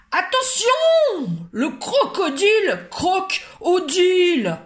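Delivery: high, loud, slow alarm cry; shouting a warning. Acted. Guard shouting that the crocodile is eating Odile, alarmed. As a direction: yell to warn the whole museum. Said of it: "cria le gardien".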